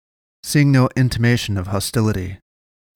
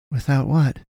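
mildly raised, simple question; asking; 4 semitones higher than usual. A narrator's reading. Boy following up on the man's broken sentence, asking. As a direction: ask it at moderate volume, slightly higher. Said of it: "Kalen asked".

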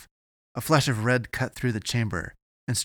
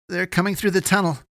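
high, loud warning; alarmed announcement; 11 semitones higher, 7 dB louder than usual. A narrator's reading. Eddie warns Avery as they flee, alarmed announcement. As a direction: shout the danger to a companion. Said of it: "Eddie announced".

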